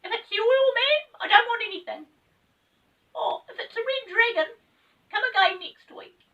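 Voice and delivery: very high, loud calling out; high voice shouting out; shouted. Acted. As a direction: shout through the closed door. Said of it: "The voice shouted again".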